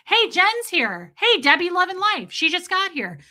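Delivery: bright host greeting viewers; excited greeting. Shown as a demonstration. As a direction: call out hello to arrivals. Greeting viewers as they arrive.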